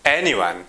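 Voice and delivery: "anyone" stressed on its first syllable, AN; "anyone" as AN-y-one, a careful teaching demo. Shown as a demonstration. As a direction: hit the start of "anyone", then let it fall. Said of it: "At the beginning".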